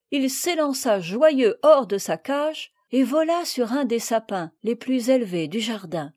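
usual speaking voice

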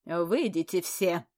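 loud, slow commanding order. Acted. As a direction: clear the room with one order. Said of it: "повелела графиня".

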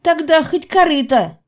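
pushy, high-pitched insistence; insistent demand. Acted. Heroine bargains back insistently.

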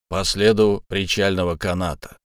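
ordinary delivery, nothing marked

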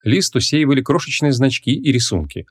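ordinary delivery

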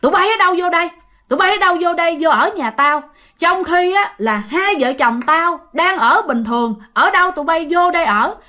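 high, pressing angry tirade; fierce male ghost scolding. Acted. Unseen man ordering the couple out of his house, fierce and scolding. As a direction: scold intruders fiercely, pitched well above normal. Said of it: "giọng này rất là hung dữ các bạn, la chửi".

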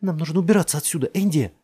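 high, loud and fast; urgent. A narrator's reading. Jess urging Andy to flee, fast and loud. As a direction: rush him out of here.

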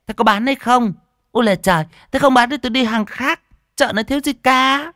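very high, loud scornful tirade; high, loud fishwife scolding; sharp-tongued shrew. Acted. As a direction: scold and sneer like a market bully. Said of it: "hứ một cái chua ngoa".

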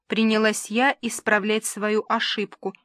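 usual speaking voice

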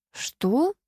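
high, rising interruption; shocked exclamation. A narrator's reading. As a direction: cut in with a startled question. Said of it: "перебив Светку, воскликнула Ксения".

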